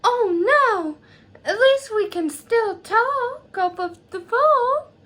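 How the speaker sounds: high, exaggerated southern accent. Best friend reacting to the moving news, southern accent. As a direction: slip into a dramatic southern accent. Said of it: "sorry for that southern accent".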